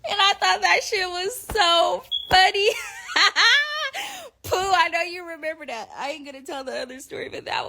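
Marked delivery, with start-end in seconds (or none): 2.7-4.4 high cackling laugh; real laughter. Real, not acted. Cracking up retelling the prank, real laugh. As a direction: burst out laughing.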